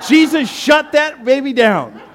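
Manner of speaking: slow, loud, high laughing exclamation; laughing shout. Real, not acted. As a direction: shout the punchline through laughter. Laughing punchline about the woman.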